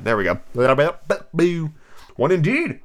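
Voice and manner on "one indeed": higher, huffy Dormouse; understated indignation; indignant. Acted. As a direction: huff it with mild offence. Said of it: "said the Dormouse indignantly".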